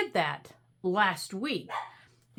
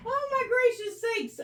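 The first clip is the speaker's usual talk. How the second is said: flustered exclamation; 13 semitones higher than usual. Real, not acted. Flustered search.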